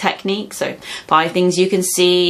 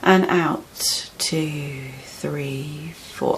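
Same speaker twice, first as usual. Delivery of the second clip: slow breathing count; much slower than usual. Shown as a demonstration. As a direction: count them calmly through each breath. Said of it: "Just say".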